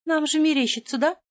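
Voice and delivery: high, scared little squeak; frightened squeak. Acted. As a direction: squeak it out in fear. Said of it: "пропищала напуганная подруга".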